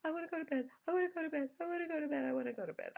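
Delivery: put-on high, loud whining child, repeated; whiny crying kid. Son crying in the bathroom, high whiny wail. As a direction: whine it like a tired crying boy. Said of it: "He's crying".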